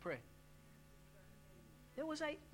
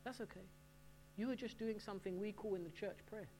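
gentle reassurance; 8 dB quieter than usual. Retold or imitated. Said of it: "The counselor says".

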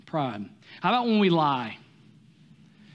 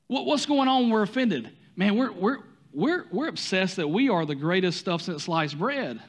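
ordinary talk

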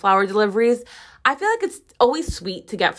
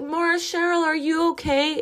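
put-on high, sweet little kid asking if she's okay; imitating a worried second grader, pitched up; child's voice; 7 semitones higher, 3 dB quieter than usual. The teacher imitates her pupil asking about her cracked voice, childlike and high.

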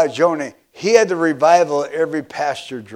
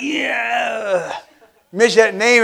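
mock sarcastic; 5 semitones higher, much slower than usual. Mocks someone hearing a hated name.

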